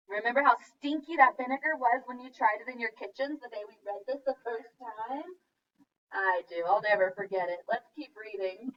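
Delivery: ordinary talk, nothing marked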